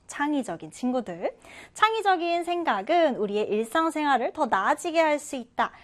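usual speaking voice

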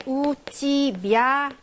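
drawn out syllable by syllable; slow modelling. Shown as a demonstration. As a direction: stretch each syllable for learners to follow. Teacher models phrase syllable by syllable.

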